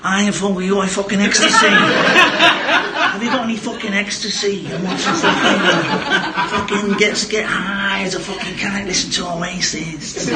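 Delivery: exaggerated Scottish impression; mock Scottish accent. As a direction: take off a Scot, badly and laughingly. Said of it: "how's my accent again".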